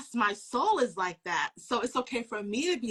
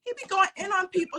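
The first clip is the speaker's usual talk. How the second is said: excited retelling; 9 semitones higher, 6 dB louder than usual. Retold or imitated. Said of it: "I was like".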